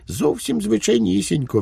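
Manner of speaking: high-pitched, artless reply; guileless Švejk. A narrator's reading. Švejk answers the police commissioner how he got here, guileless and high.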